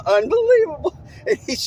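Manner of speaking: high, loud squeal of laughter into the word; shrieking laugh. Real, not acted. Reacting to rev limiter story.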